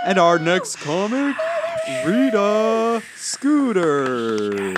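high, drawn-out stage introduction; announcer. Pretend. MC bringing the next comic on, in a big announcer voice. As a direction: stretch the name out like a club MC.

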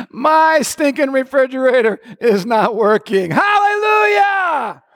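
high shouted praise, stretching out the hallelujah; joyful defiant shout. Pretend. Believer mocking the devil over a broken fridge, high shouted hallelujah.

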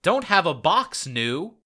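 "Don't have a box, new." loud and high, misspelt Bart catchphrase; hammed Bart misprint "Don't have a box, new." said loud; garbled Bart catchphrase. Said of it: "stating his famous catchphrase".

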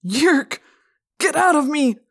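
high, loud, desperate yell; anguished cry. A narrator's reading. Possessed teacher clawing at his head, crying out. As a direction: cry out to expel an invader. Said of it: "And he cried".

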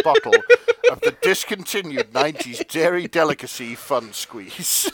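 ordinary talk

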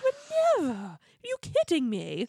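hammed-up bad British accent; Lisa Vanderpump. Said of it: "my horrible British accent".

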